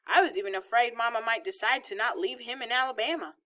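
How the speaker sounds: plain reading voice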